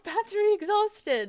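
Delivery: high, loud mock-panicked battery warning; hammed-up dismay; mock panic alert. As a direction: wail it like the gadget is dying. Camera battery dies on stream.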